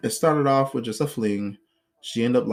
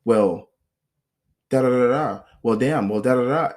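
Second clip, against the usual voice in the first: mock angry retort, louder; imitating her yelling; 5 dB louder than usual. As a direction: play her arguing with herself. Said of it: "She'll go".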